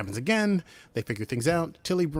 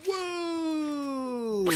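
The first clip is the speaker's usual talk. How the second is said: long, very high-pitched "whoo"; high celebratory whoop. Real, not acted. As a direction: let out a drawn-out whoop of relief. Celebrating the end of the recap.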